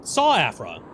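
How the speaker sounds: high, startled realization; surprised. Real, not acted. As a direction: blurt it out in shock. Sudden confusion, high pitch.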